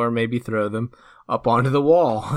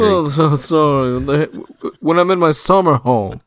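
hammed-up posh upper-class accent, higher, louder and slow; mock posh accent; 6 semitones higher, 8 dB louder, slower than usual. Joking with co-host about his summer home, mock posh accent. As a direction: play a snooty rich type in a posh accent. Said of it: "if you're gonna do the accent".

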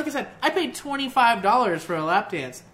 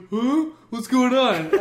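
higher, louder bewildered voice; confused Danny; 5 semitones higher, 7 dB louder, slower than usual. Retold or imitated. Imitating confused shirtless Danny in the club, louder bewildered voice. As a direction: play the lost shirtless friend. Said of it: "He's just like".

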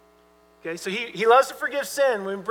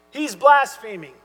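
accusing; 7 dB louder than usual. Retold or imitated. Scribes' accusation voiced.

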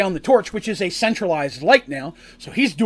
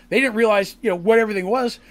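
usual speaking voice

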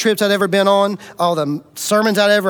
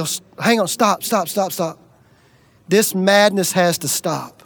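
tearful plea; 4 semitones lower, 3 dB quieter than usual. Retold or imitated. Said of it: "Gina begins to cry".

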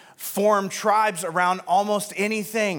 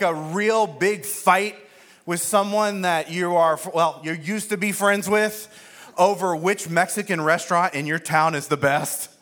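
ordinary delivery

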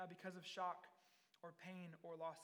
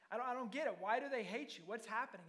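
higher, louder bewildered questions; freaking out; 3 semitones higher, 6 dB louder, faster than usual. Acted. Pilate pulling Jesus aside, bewildered and agitated. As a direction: plead for an explanation, mildly frantic. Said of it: "he's freaking out".

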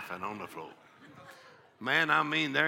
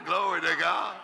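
ordinary talk

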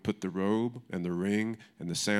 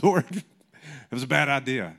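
sheepish confession; 4 semitones higher, 5 dB louder than usual. Retold or imitated. Confessing a mistake to God.

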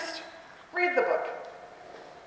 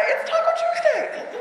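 put-on much higher, laughing kid shout; excited child. Teacher imitating a child spotting Taco Tuesday, high and laughing. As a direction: squeal it like a delighted kid. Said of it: "some child will say".

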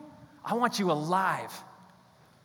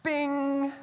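bing sound; 10 semitones higher than usual. Retold or imitated. Sudden idea sound effect.

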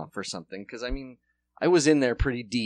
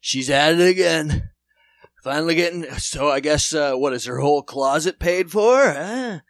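mocking Dunkin' guy; 2 semitones higher, 3 dB louder than usual. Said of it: "overheard a heated conversation".

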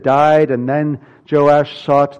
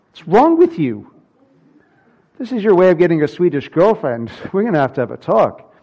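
mock-exasperated dad, higher and quick; mock scolding; 5 semitones higher, 4 dB louder, faster than usual. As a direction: playfully tell off a son, lightly hammed-up. Acted scolding of his son.

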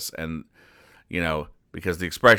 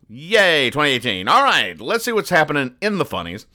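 high, bouncy show-host excitement; upbeat cheer; 8 semitones higher, 4 dB louder than usual. Real, not acted. Cheering on the new year and show.

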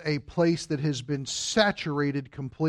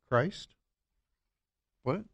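usual speaking voice

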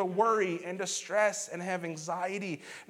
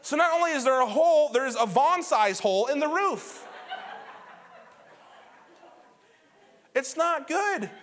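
laughing disbelief; 8 semitones higher, 4 dB louder than usual. Real, not acted. Laughing at hole in roof.

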